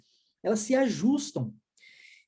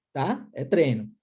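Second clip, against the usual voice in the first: ordinary talk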